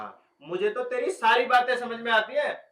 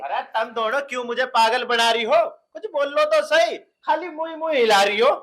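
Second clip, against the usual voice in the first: loud exasperation; 3 semitones higher, 6 dB louder than usual. Acted. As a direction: shout as if you can't hear yourself. Cotton in ears, hears nobody.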